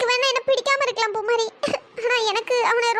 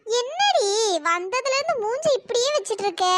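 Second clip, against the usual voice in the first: normal talking voice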